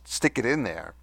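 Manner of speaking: ordinary talk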